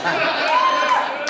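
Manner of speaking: high squealed shout; mock squeal. Comic to crowd, a shrill mock squeal. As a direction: squeal it like a silly punchline. Mocking the nightclub hookup.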